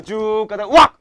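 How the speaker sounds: slowish lead-in, then a loud 왁 burst; sudden shout. Shown as a demonstration. Teacher to class, acting out the stop codon with a loud burst. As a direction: blurt 왁 like slamming on the brakes. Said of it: "하면 끝내는 거라고".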